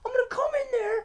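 imitated screamed threat; strained, high-pitched hammed-up rage; Trip. Boss threatening to storm the shop, imitated scream. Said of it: "He's screaming at the top of his lungs".

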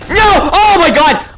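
high, loud outburst of dread; alarmed cry. Real, not acted. Player sees Foxy attack at five A M, alarmed cry. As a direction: cry out as doom hits.

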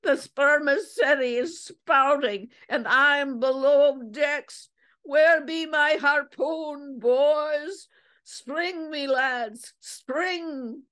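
high, drawn-out whaler's holler; creaky shout. A narrator's reading. Crazed whaler calls his lads to the harpoon, creaky shouting. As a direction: call to the crew in a creaky, high voice. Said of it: "shouting in a creaky voice".

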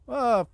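sudden sharp shout of fright; startled yell. Real, not acted. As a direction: yelp out, caught off guard. Sudden zombie attack in game.

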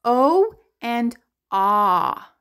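"O and ah" slowly, each vowel held; letter O sounds. Shown as a demonstration. Teacher answering what O says, slow separate "O and ah". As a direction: draw out long "O", then open "ah". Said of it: "The O says".

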